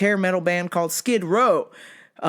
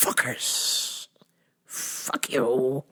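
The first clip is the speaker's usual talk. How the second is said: muttered curse; 5 semitones lower, 5 dB quieter, much slower than usual. Retold or imitated. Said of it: "I was like".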